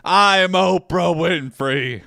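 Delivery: loud, high triumphant proclamation; Oprah Winfrey. Acted. Player as talk show host defying a threat, loud boast. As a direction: boom it out, stretching the name grandly.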